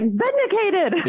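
high, drawn-out cry of victory; triumphant. Retold or imitated. Daughter told by mom she was right at last, triumphant. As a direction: crow it in mock triumph, stretching it out. Triumphant retort to mom's belated admission.

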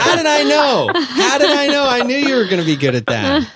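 high, loud delighted laughter; cracking up with glee at the caller; excited laughing. Real, not acted. Host thrilled by caller's impression.

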